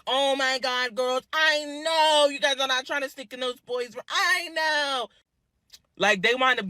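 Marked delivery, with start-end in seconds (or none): 0.1-5.1 high put-on scolding lady; mock the chaperone catching them; imitating chaperone. Chaperone catching girls at the boys' room, high mock scold.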